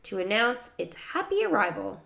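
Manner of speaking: plain reading voice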